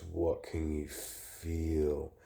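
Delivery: each word stretched and eerie; weird slow voice. Retold or imitated. The woman asks him again in an uncanny drawn-out voice. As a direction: slowly draw the question out in a strange, otherworldly tone. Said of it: "now her voice, it's weird".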